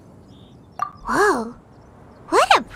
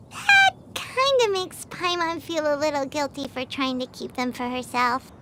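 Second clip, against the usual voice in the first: softer, lower, sheepish confession; guilty mumble; 6 semitones lower, 7 dB quieter than usual. Acted. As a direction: own up a little ashamed. Admits hiding snacks.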